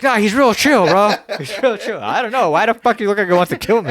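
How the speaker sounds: high, loud mock reassurance. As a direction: play the owner talking up the dog. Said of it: "It's like".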